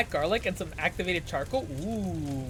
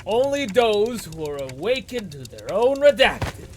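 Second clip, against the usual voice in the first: grand, swooping incantation; theatrical prophecy with rising and falling sweeps; dramatic verse reading; 3 semitones higher, 5 dB louder, slower than usual. Acted. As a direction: proclaim it loudly, stretching the key words. Game incantation read aloud.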